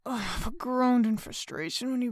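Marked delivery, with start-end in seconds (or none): none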